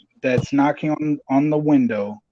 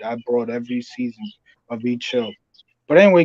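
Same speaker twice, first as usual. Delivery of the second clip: ordinary talk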